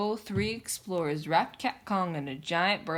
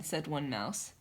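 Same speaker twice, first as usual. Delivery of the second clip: ordinary delivery, nothing marked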